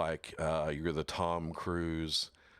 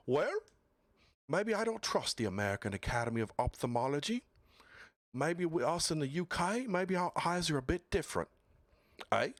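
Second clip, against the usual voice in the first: higher-pitched, badly faked British accent; hammed-up British voice, pitched up; 8 semitones higher, faster than usual. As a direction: do a clumsy British impression. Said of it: "I know my British accent is bad".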